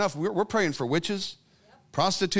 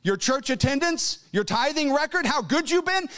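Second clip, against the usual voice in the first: mocking; 10 semitones higher than usual. Mocking wrong answers to congregation.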